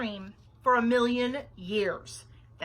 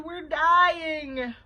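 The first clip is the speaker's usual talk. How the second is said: loud, higher melodramatic moan; 6 semitones higher, 10 dB louder than usual. A narrator's reading. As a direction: wail it as an exaggerated plea. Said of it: "moaned Bean".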